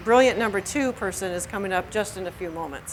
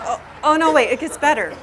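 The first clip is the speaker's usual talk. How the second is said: laughing, higher and louder with glee; relishing a story; 5 semitones higher, 7 dB louder than usual. Real, not acted. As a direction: savor the best part, thrilled. Gleeful build-up to punchline.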